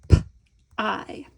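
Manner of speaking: P-I as a puffed "p", then a separate "eye"; sounded out. Shown as a demonstration. Teacher reviewing first and second sound, sounded out. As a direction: pop the P in P-I, pause, say long I. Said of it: "and the second sound".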